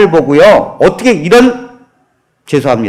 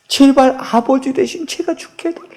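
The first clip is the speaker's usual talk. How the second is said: pleading whisper; 7 dB quieter than usual. Acted. Mute daughter pleads with father.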